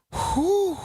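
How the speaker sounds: high, whistled whew of relief; relieved whew. Pretend. Streamer hears the story was debunked, high relieved whew. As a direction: blow out a high sigh of relief.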